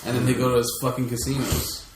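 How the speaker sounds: usual speaking voice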